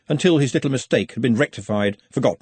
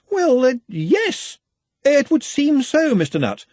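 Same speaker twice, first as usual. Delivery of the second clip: slow, higher, unsure yes; stall, then commit to the praise; hesitant reassurance; 12 semitones higher than usual. A narrator's reading.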